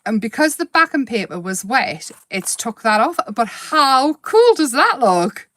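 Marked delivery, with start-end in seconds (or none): 3.3-5.5 excited. Real, not acted. Admiring happy accident, slow.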